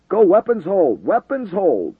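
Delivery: high, loud urgent order; shouting. A narrator's reading. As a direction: bark the command over the radio. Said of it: "the controller shouted".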